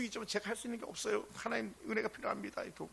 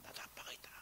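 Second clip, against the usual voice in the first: put-on whispered child quote; 18 dB quieter than usual. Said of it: "네 살 정도 때".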